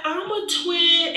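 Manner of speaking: high, slow mimicked doctor voice; mock chirpiness, drawn out; chirpy doctor. Mimicking the unwelcome chatty doctor.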